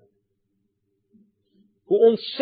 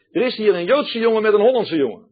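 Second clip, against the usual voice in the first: higher, louder call toward the back room; 7 semitones higher, 5 dB louder than usual. Retold or imitated. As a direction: call it through the house. Sexton's wife calling to back room.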